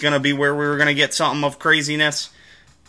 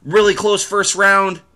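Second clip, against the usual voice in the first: tweet reaction; 7 semitones higher than usual. Retold or imitated. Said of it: "getting kind of the live reaction".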